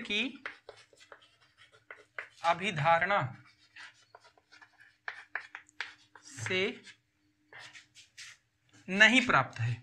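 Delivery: slow, broken into single phrases; write-along dictation with long gaps; dictating. Real, not acted. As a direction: speak each piece as you write it. Dictating answer while writing it out.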